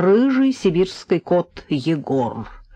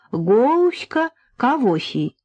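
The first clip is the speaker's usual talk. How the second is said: put-on higher, slow little-child lisp; toddler baby talk; 6 semitones higher, much slower than usual. A narrator's reading. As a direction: echo it like a tiny child. (по-детски, картавя и шепелявя)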